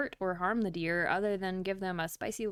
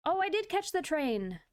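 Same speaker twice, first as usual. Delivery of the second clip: excited; 8 semitones higher, 3 dB louder than usual. Real, not acted. Surprised she caught train.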